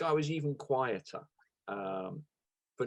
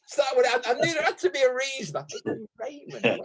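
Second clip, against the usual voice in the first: laughing; 11 semitones higher than usual. Real, not acted. Laughing at the nickname reveal.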